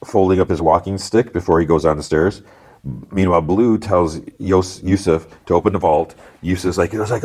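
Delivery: ordinary talk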